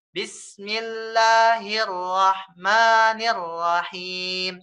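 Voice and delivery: slow, drawn recitation, each long vowel held one swing; one-beat madd demo. Shown as a demonstration. Teacher demonstrating the two-beat length to student, slow recitation. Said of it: "Ibarat kata kita punya ayunan".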